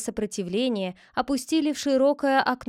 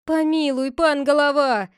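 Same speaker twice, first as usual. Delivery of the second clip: higher, louder plea; pleading cry; 5 semitones higher, 6 dB louder than usual. Acted. Villagers beg the head, bowing, pleading. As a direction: beg for mercy. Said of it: "закричали некоторые".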